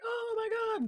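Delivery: high, very soft gasped reaction; tiny high-pitched imitation of shock; hushed hammed-up amazement; mock awe. Acts out reaction to aliens.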